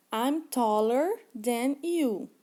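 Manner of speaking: very slow, carefully spelled-out English for learners; slow model sentence. Shown as a demonstration. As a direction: read it slowly like a teacher modeling. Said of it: "o exemplo está assim". (frase em inglês lenta e bem articulada)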